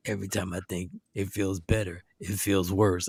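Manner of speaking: low, soft, slurred sleepy mumble; groggy drugged voice. Retold or imitated. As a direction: sound doped up on painkillers. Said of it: "he was like".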